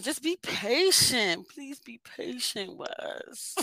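slow, softening plea for patience; pleading. Real, not acted. Asking men on the podcast to be patient, slow soft plea. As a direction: draw it out, asking gently.

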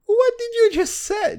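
high, loud what-the-hell outburst; baffled by what he just heard; stunned disbelief. Real, not acted. Reacting to unintelligible lyrics.

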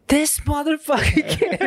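very high, laughing mock fury; mock outrage through laughter. Dad mock-angry at a clever toddler, laughing high. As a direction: squeal it in fake anger while cracking up. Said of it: "And I was just like".

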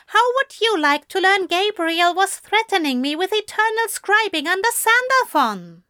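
high-pitched exasperated tirade; crying in frustration. A narrator's reading. Frustrated angel reproaching Crowley for hiding the burden. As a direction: pour out the grievance in a high voice. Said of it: "Aziraphale cried in frustration".

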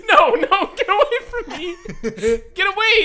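very high, loud laughing whine; embarrassed protest. Retold or imitated. Boy protests holding teacher's hand, high loud laughing whine. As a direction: squirm away in mock horror.

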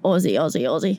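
low, lightly put-on Australian accent; attempted Australian accent. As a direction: try the word like an Australian. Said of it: "Would you say Aussie?".

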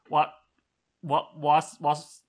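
stumbling, halting over the name; words failing mid-name; voice giving out. Real, not acted. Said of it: "I'm losing my ability to speak".

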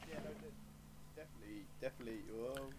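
faint voice dropping in and out; bad mic, sound fading away; mic cutting out. Real, not acted. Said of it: "you were quiet for a".

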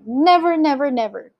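high, insistent pleading; fervent, frightened vow; desperate cry. A narrator's reading. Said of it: "cried Mister Gregg".

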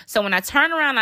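ordinary talk, nothing marked

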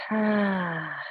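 long falling "ah" on the out-breath; audible exhale sigh. Shown as a demonstration. Yoga teacher showing how to exhale, long sighed "ah". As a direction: let a drawn-out sigh sink away. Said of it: "Now using the sound".

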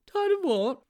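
high, loud character voice, not murmured; absent-minded sidekick, pitched right up; high-pitched puzzled sidekick; Sponge's distracted question. A narrator's reading. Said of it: "murmured Sponge".

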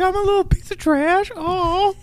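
put-on tiny high-pitched child voice; pitiful mock squeak; mock hurt kid. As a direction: imitate a wounded little girl, very high. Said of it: "She's like".

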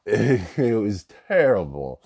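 ordinary talk, nothing marked

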